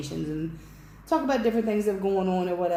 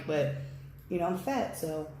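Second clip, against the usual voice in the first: ordinary talk, nothing marked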